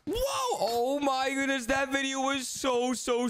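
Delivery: high-pitched wowed exclamation; amazed. Acted. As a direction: marvel at it in a high voice. Amazed by the video.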